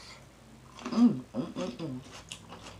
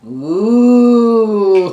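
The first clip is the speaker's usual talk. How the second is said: excited ooh; 5 semitones higher, 15 dB louder than usual. Real, not acted. Reacting to delicious bite.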